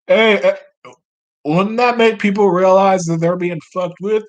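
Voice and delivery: high, loud put-on voice; piping-up senator. Senator questioning the benefactor, high loud put-on objection. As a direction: pipe up high from the crowd. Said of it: "One of them pipes up".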